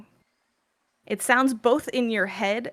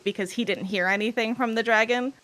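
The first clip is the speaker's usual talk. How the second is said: usual speaking voice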